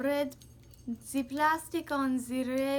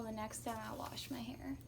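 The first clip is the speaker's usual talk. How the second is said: whispering; 8 dB quieter than usual. Real, not acted. Whispered aside about hair.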